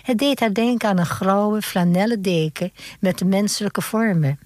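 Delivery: ordinary delivery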